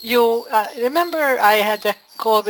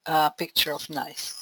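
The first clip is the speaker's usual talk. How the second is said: normal talking voice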